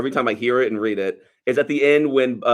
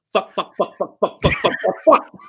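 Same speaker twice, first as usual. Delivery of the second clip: mock frustrated cursing; 8 semitones higher than usual. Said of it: "And he just goes".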